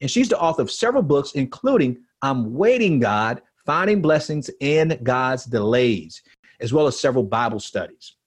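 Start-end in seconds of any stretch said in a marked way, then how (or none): none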